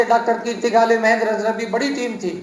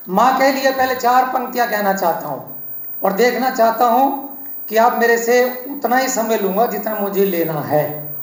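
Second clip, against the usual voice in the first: ordinary talk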